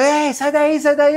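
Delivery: half-acted crowd yelling to get out; retold yell. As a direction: call out a warning from the beach. People yelling at the diver.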